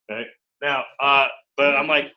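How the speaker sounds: normal talking voice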